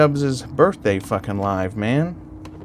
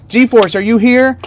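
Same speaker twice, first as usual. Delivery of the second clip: calling out; 9 semitones higher, 5 dB louder than usual. Real, not acted. Calling to a viewer in chat.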